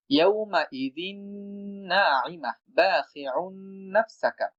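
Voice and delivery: slow, drawn-out tajweed chant; Quran recitation. Shown as a demonstration. Teacher recites an example verse slowly for students. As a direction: stretch each syllable of the verse.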